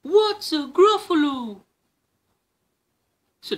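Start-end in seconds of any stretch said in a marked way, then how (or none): 0.0-1.6 high, loud curious owl; a hooting owl asking. Acted. Owl asks the mouse what a Gruffalo is.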